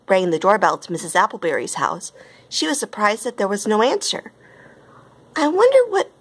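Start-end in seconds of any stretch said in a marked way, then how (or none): 5.3-6.1 high-pitched, wondering tone; storybook character wondering; puzzled thought. A narrator's reading. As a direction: puzzle it aloud, starting high. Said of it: "thought Barbie".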